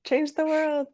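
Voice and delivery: high, playful rallying call; rally her like a friend; excited cheer. Real, not acted. Host urges a guest to change the world, excitedly.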